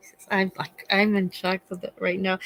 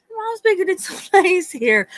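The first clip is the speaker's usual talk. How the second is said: much higher, mocking sing-song; mocking girlfriend; 10 semitones higher than usual. Mom mocking the girlfriend's compliment in a high sing-song voice. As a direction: parrot her words back in mockery. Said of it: "You think I'm setting the bathroom ambiance".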